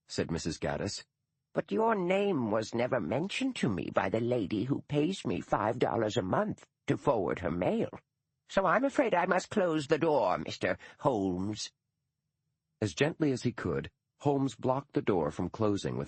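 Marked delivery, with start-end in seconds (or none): 1.5-11.7 put-on a stiff elderly schoolmistress, proud and put out; dour old retired teacher. Gaddis refuses him.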